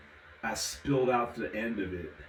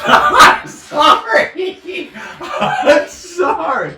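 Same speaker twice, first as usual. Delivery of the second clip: helpless laughter through sorry; laughing apology; 18 dB louder, much slower than usual. Real, not acted. Reading a funny commenter name, laughing apology. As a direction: squeal it out while cracking up.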